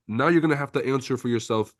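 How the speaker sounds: ordinary talk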